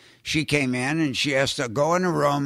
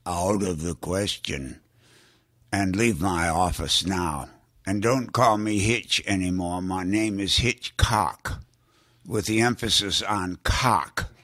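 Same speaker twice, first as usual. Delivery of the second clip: Hitchcock voice; 6 semitones lower than usual. Retold or imitated. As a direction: lower the voice into a haughty Hitchcock. Hitchcock voiced in retold story.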